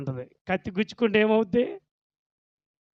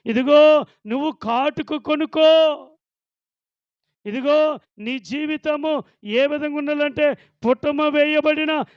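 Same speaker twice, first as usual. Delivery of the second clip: higher, louder preacher voicing the Lord's stern warning; Lord's warning voiced; 7 semitones higher, 5 dB louder than usual. Preacher voicing the Lord warning the church, raised and firm. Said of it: "హెచ్చరిస్తా ఉన్నాడు".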